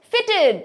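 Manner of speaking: high ফিটেড with a light -id; short -id ending. Shown as a demonstration. Teacher models past tense, ফিটেড ending -id. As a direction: say "ফিটেড" as FIT-id. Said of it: "আইডি উচ্চারণ".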